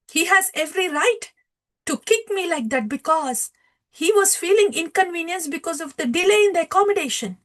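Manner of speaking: high, insistent acted voice; husband's rebuke. Shivananda Sen defends the one who kicked him, insistent. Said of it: "Shivananda Sen says".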